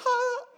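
high sharp "Ha!"; mock shout. Choir member's sudden solo cry, high and sharp. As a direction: bark out a high falsetto ha. Soloist's outburst.

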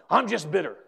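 loud, punched-out bitter complaint; embittered. Retold or imitated. Pastor imitating people he tries to help, bitterly. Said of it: "They say it just like that".

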